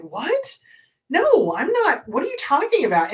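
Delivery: high, loud shocked protest; shocked disbelief. Retold or imitated. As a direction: act stunned at the accusation. Retold shock at being accused.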